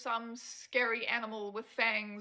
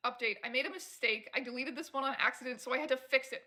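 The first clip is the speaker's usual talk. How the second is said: ordinary delivery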